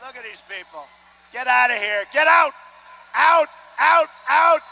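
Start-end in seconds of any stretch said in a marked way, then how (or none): 1.3-4.6 shouting get out. Real, not acted. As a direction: throw the protester out. Ejecting protester, crowd noise.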